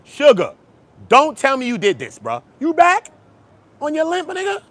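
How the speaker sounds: loud, high disbelieving call-out; mock outrage. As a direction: scold Sugar Free in loud disbelief. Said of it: "I said".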